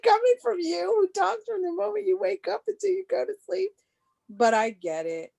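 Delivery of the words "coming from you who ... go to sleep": very high-pitched teasing comeback; mock incredulous retort. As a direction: squeal it in playful disbelief at a chatterbox. Retold teasing retort to chatty grandchild.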